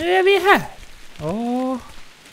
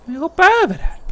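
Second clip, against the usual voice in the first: ordinary talk, nothing marked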